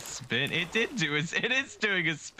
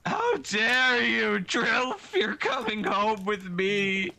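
mock outrage; 6 semitones higher, slower than usual. Scolding the rival.

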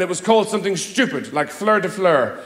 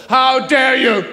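louder, hammed-up stage outrage; pompous theatrical indignation; Victorian theatre; 5 semitones higher, 5 dB louder, much slower than usual. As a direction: puff up like a stage villain. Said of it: "They feel twelve feet tall".

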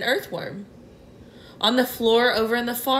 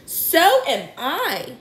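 higher, slow ladybird cry; small insect voice crying out, higher; 6 semitones higher, much slower than usual. A narrator's reading. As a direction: call it out high and drawn out. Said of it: "the ladybird cried".

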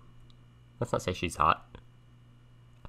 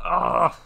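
loud, high cry of "ah"; startled outburst; 10 semitones higher, 7 dB louder than usual. Real, not acted. Player floored by friend's kiss question, loud high cry. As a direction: burst out high and loud, wordless.

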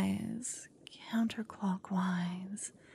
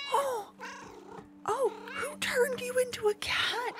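gasp, then much higher startled cries; shocked gasp; 3 dB quieter than usual. Acted. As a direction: suddenly realize your lover is a cat. Recognizes partner turned cat.

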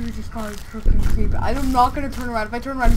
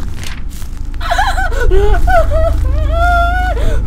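shrieking scared laugh; 5 dB louder than usual. Real, not acted.

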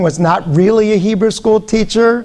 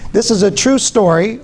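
ordinary delivery

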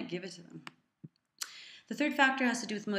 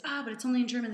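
higher, louder retold objection; mock letdown; 5 semitones higher, 5 dB louder, much faster than usual. Retelling the catch about the German book, mock letdown. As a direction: act out a small letdown, pitched up.

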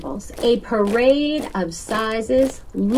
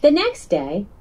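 plain reading voice

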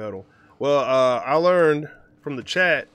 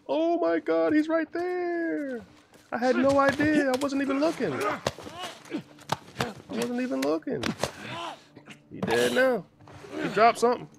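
panicking gamer; 12 semitones higher than usual. Real, not acted. Sudden enemy, high-pitched panic.

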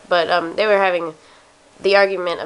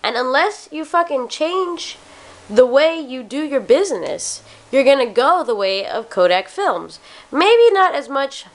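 normal talking voice

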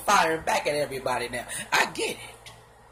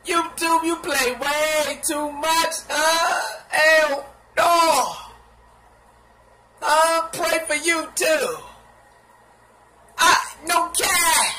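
higher, loud, slow disbelief; hell no; 7 semitones higher, 4 dB louder, much slower than usual. Real, not acted. Vlogger yelling at YouTube's recommendations, loud and slow. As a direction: protest loudly, drawn out. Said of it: "I said".

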